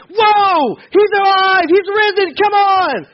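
high, loud joyful yell; excited shout. Retold or imitated. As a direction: shout it like the best news ever. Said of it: "they didn't go like".

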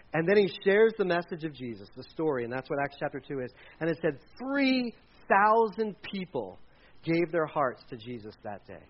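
normal talking voice

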